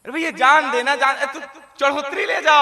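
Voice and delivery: loud, hurried plea; desperate to get away, begging; flustered guru. Acted. Hurried guru begs to pass. (घबराए गुरु की जल्दबाज़ी भरी विनती)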